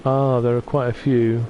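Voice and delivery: ordinary delivery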